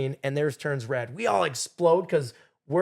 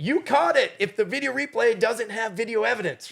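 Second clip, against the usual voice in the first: exasperated; 8 semitones higher than usual. Retold or imitated. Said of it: "I was like".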